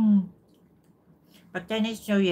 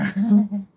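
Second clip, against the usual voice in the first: giggle; 5 semitones higher, 13 dB louder than usual. Real, not acted. Laugh after tease.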